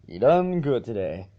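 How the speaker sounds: usual speaking voice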